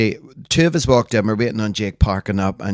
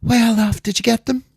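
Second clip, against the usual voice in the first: much higher, chirpy mock of the friend; imitating friend; 13 semitones higher, 3 dB louder than usual. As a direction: pitch it up high like the friend on the phone. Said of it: "she goes to me".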